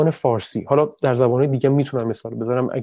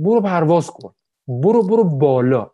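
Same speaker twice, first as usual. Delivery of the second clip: mock dismissive shout; 3 semitones higher, 6 dB louder than usual. Quoting a street brush-off.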